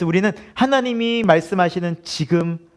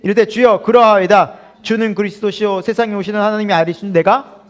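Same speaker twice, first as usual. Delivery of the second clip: solemn confession; 5 dB louder than usual. Acted. Reading Martha's confession aloud.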